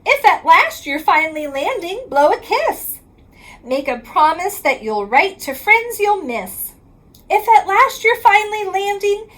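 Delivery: plain reading voice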